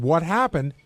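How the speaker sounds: normal talking voice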